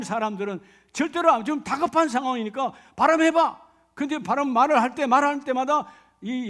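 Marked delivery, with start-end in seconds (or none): 3.0-3.6 loud, high order to a captive; barked demand. Acted. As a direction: snap the command at the prisoner. Soldiers demand the password.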